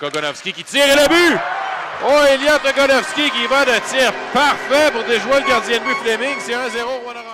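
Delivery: rising to a shouted goal call; excited goal call. Real, not acted. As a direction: build to the goal, then erupt.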